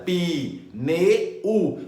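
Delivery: "pi, ne, u" as three separate beats; mock syllabifying, each of "pi, ne, u" on its own; worse three-way split. Shown as a demonstration. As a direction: chop it into "pi", "ne", "u". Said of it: "tem gente que faz pior ainda".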